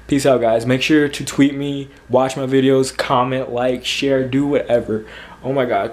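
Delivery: ordinary talk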